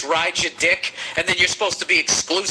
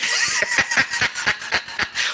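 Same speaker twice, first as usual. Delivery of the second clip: high laugh. Real, not acted. Laughing at his own ghost joke.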